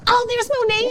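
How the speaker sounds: high, thrilled spotting of a friend; light up as if you just spotted her on screen; excited recognition. Retold or imitated. Host recalling watching the film and spotting her, voice jumping high. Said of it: "I was go".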